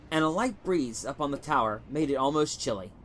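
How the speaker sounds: usual speaking voice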